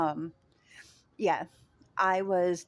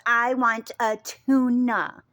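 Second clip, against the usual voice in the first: stubborn customer, louder; 5 semitones higher, 7 dB louder, slower than usual. Retold or imitated. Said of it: "And he goes".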